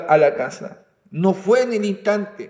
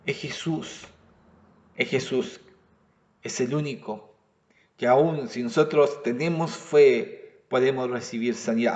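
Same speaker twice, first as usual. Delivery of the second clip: soft, lower preaching voice, drawn in close; hushed sermon tone; 6 semitones lower, 7 dB quieter than usual. Real, not acted. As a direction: speak it softly, as a tender assurance. Preacher's hushed emphasis on Jesus.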